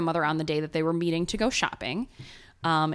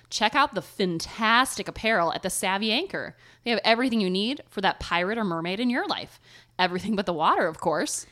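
ad read; 2 semitones higher, 3 dB louder than usual. Acted. Cheery pirate ad read.

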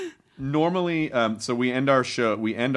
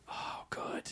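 whispering; 18 dB quieter than usual. Real, not acted. Hushed aside.

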